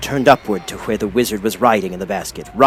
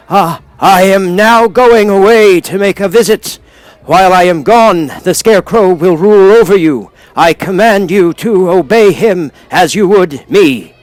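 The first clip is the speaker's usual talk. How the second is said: loud proclamation; 9 semitones higher, 9 dB louder than usual. A narrator's reading. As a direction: announce it grandly to the whole crowd. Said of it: "said to all the people in a loud voice".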